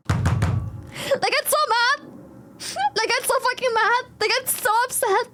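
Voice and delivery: high gleeful exclaim. Real, not acted. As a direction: delight in their rage.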